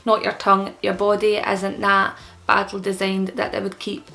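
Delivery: ordinary talk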